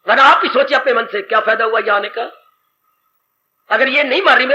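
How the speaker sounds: ordinary delivery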